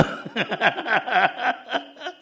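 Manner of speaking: high giggling laugh, climbing in pitch; a long, rippling high laugh. Real, not acted. Preacher amused by how they got rid of him, high laughter. Laughs after recounting his release.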